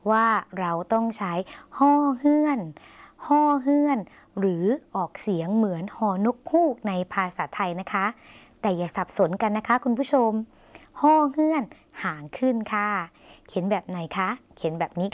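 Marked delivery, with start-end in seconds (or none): 1.8-4.0 ฮ้อเฮือน held high and level; letter-name pronunciation. Shown as a demonstration. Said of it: "เสียงก็บอกแล้วใช่ไหมคะ". 11.0-11.6 ฮ้อเฮือน held high and level; letter-name pronunciation. Shown as a demonstration. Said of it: "เสียงก็บอกแล้วใช่ไหมคะ".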